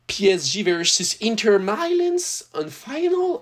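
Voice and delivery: slow, high hyped-up announcement; hyping the PSG final to viewers, announcer voice; mock match announcer. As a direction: call out the final like a stadium announcer.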